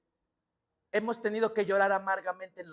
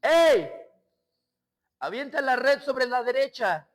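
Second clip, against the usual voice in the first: calling out across the water; 2 semitones higher, 6 dB louder than usual. A narrator's reading. Jesus calling to Peter from the shore, loud hail. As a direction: shout to a boat far off. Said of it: "le grita a Pedro".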